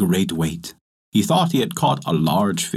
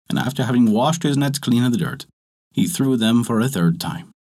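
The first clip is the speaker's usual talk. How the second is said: ordinary delivery, nothing marked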